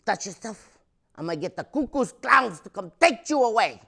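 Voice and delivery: loud, high mom threat; mock threat. Mom threatening kid with the Klan, loud mock scolding.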